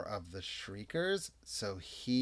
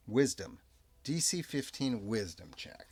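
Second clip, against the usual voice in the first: ordinary talk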